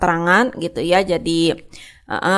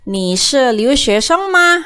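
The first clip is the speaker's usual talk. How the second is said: slower question with the pitch climbing to a high "ma"; question, rising tune; 5 semitones higher, 3 dB louder, slower than usual. Shown as a demonstration. Teacher shows students how a question sounds, rising intonation. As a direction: ask it with the voice lifted high through "sheng ma". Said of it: "Jadi naik gitu ya".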